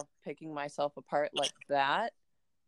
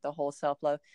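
ordinary delivery

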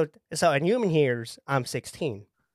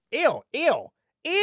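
high, repeated cries of disgust; grossed out; 13 semitones higher than usual. Acted. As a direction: recoil from an unwanted mental picture. Recoils from parents' sex life.

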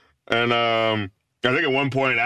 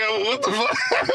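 shocked exclamation; 10 semitones higher than usual. Retold or imitated. Retelling own shock at celebrity.

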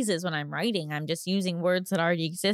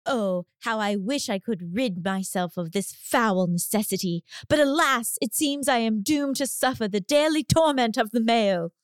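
Shakespearean voice. Acted. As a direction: declaim like a Shakespearean actor. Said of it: "I need you to use a Shakespearean voice".

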